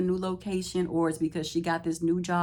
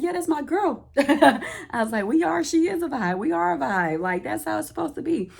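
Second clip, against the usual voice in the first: excited reply; 10 semitones higher, 6 dB louder than usual. Retold or imitated. Speaker retells own excited reply.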